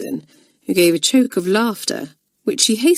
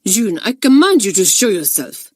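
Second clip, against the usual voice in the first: snapped; 3 semitones higher, 4 dB louder than usual. Acted.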